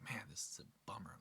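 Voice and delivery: whispered disappointment at his reflection; whispered to himself. Retold or imitated. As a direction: mutter it dejectedly into the mirror. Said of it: "I'm just like".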